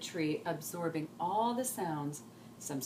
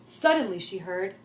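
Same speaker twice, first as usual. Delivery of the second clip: usual speaking voice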